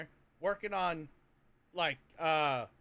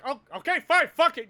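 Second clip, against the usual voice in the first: high, louder, fed-up surrender; exasperated giving in; 7 semitones higher, 9 dB louder than usual. Retold or imitated. As the recruit, cornered and exasperated. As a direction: throw up your hands. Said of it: "you're like".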